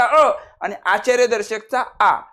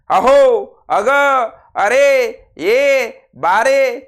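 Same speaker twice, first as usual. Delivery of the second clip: calling louder to someone across the room; 5 semitones higher, 5 dB louder, much slower than usual. Shown as a demonstration. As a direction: call each one out to get attention. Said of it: "हाक मारण्यासाठी वापरतात".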